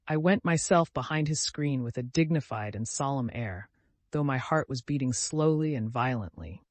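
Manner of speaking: ordinary delivery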